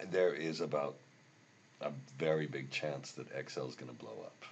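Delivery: normal talking voice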